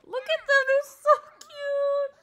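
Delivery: very high, loud delight; gushing squeal. Real, not acted. Player gushing over her cute characters, delighted squeal. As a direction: squeal it, drawing out the end.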